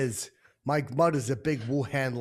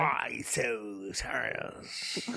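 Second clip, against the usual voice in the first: slow creepy Joker drawl; Joker impression; 2 semitones lower, 5 dB quieter, much slower than usual. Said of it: "I'm like the Joker".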